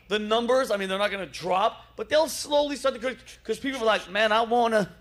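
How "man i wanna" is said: put-on southern accent. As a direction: slip lightly into a Southern accent. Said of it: "notice how I went to the southern accent".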